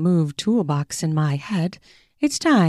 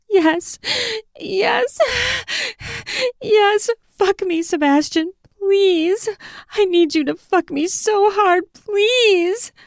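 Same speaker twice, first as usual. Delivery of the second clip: desperate pleading. Acted. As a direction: beg breathlessly, high. Woman begging during sex.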